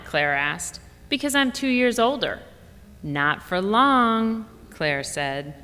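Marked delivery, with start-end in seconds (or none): 1.1-2.4 put-on little girl voice pitched higher; smug older sister stating the obvious; light kid voice, matter-of-fact; child explaining. Georgia explaining age to sister. 3.0-4.5 put-on drawn-out, very slow little-girl comeback; slow, higher kid voice; slow child retort. Claire retorting to sister.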